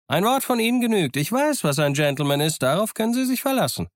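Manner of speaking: very high, jolly pirate voice; hearty, high-pitched assurance; cheerful call. A narrator's reading. Silver reassures the captain, calling out cheerfully. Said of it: "rief Long John fröhlich".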